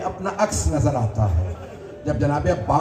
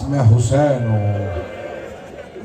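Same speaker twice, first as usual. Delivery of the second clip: tender low call; 7 semitones lower, much slower than usual. Acted. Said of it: "मौला ने आवाज़ दी".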